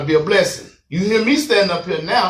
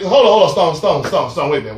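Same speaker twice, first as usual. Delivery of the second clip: normal talking voice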